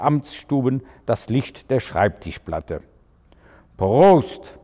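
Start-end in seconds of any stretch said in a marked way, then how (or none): 3.8-4.6 lautes, dröhnendes Prost. A narrator's reading. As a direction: wie ein Trinkspruch durch den Raum gedröhnt. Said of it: "dröhnte Otto Prick". (dröhnend laut)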